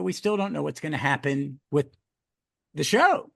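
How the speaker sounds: usual speaking voice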